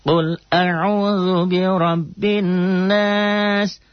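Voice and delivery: high, slow chanted recitation; Quran recitation demo. Shown as a demonstration. Teacher chanting the verse for students, slow and high. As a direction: draw out the Arabic like a reciting teacher. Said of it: "Kok cepat kan direkam".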